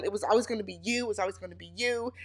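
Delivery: whisper-soft tender mock quote; soft quoted line. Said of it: "they talk about how they knew".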